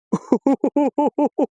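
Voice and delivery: high string of rapid ha-ha laughs; elated laughter. Real, not acted. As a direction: giggle helplessly with joy. Overjoyed at the coin find.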